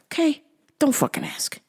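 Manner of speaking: hushed aside; whisper. Real, not acted. Whispering to viewers not to ask. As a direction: drop to a whisper, dead serious. Aside after dark joke.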